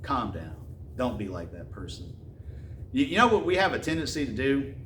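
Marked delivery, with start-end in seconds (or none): 0.0-2.2 softer, lower, a hint of talking someone down; calming voice. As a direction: gently talk a hothead down. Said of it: "someone to say".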